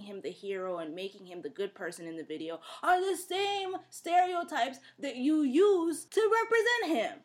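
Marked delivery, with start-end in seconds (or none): 2.8-7.2 high-pitched, exasperated challenge; outraged disbelief. Real, not acted. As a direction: push the pitch up, indignant and emphatic. Outraged rant about the video.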